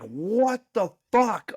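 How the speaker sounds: high, drawn-out re-enacted astonishment; amazed disbelief. To a long-lost school friend, amazed disbelief. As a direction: stretch it out high in mock shock.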